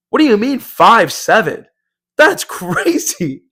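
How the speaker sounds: high-pitched, amused outrage breaking into a laugh; incredulous. Retold or imitated. Member reacting to height insult, incredulous. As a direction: act affronted but find it funny.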